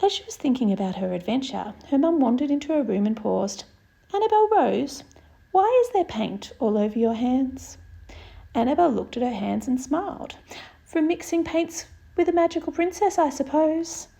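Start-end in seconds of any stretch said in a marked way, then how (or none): 4.1-7.8 surprised mum. Acted. Mum calling out. 10.8-14.1 put-on little girl voice. Annabel answering sweetly.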